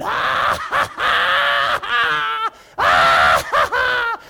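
very high loud cackling laughter through the words; hammed-up drunk laughter; drunken laughing. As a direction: laugh wildly between the words. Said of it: "lay there laughing".

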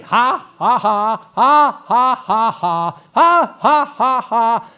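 long run of loud, forced "ha"s; laughing at the devil. Acted. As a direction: keep up a deliberate defiant laugh. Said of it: "you go to laughing again".

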